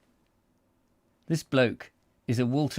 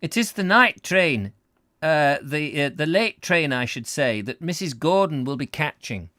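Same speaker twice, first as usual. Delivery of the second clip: raised, put-on Scottish voice; Scottish accent; 6 semitones higher, 6 dB louder than usual. Husband explains Missus Gordon's late train, Scottish accent. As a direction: get right into the Scottishness. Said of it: "Just get into the Scottishness".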